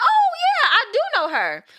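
high, loud burst of recognition; excited recognition. Retold or imitated. Recognizing Tanya in the picture, excitedly loud. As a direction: act out suddenly knowing the face.

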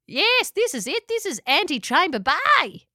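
high, excited pelican cry; cried out. Acted. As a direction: call out with delight. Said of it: "cried out Louise".

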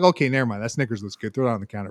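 muttered low and soft, giving in; a soft mock surrender, talked into it. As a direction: quietly cave on the snacks. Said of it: "I'm like".

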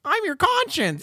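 high, drawn-out cartoon voice; tiny high character voice; Jiminy Cricket. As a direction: pitch it way up and go slowly. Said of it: "Jiminy Cricket".

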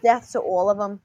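put-on quoted line in a light Jersey accent; mock Jersey accent. Quoting a talk show host, light Jersey accent. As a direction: imitate her with Jersey vowels. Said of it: "why'd you give her a freaking Scottish accent".

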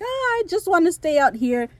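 high, pleading reluctance; reluctant Lucius. Retold or imitated. Lucius begging to stay out, high pleading. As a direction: whine to stay outside.

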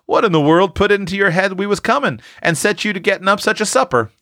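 high, quick astonished question; pleased surprise. A narrator's reading. Father sees the plentiful table, high quick surprise. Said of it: "well pleased and much surprised".

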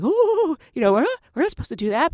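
high, quick nervous whisper-worried protest; mock alarmed junior; nervous ooh. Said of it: "the junior girl's just looking like".

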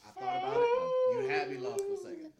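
high-pitched fake sob voice; mock crying. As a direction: pretend to be choked up, voice high. Mock emotional high-pitched admission.

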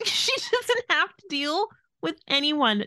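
usual speaking voice

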